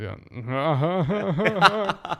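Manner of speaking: high, loud imitated laugh; Kawhi. Podcaster mimicking a basketball star's laugh, Kawhi impression. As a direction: put on a stilted string of ha-has. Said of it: "Better than my Max impression".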